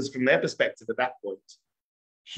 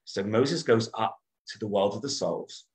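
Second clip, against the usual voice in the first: normal talking voice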